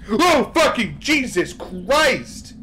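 loud, high, drawn-out shock; jump-scare outburst, pitch shooting up; startled scream. Real, not acted. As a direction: yelp the curse in real fright. Sudden scare in horror game.

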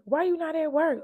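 playfully put-on questioning; joking. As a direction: imitate a boss teasing, pitch lifted. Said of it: "Like, joking and playing around".

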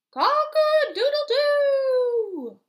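very high, loud, slow crow; crowing proud rooster. Acted. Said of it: "crowed the rooster".

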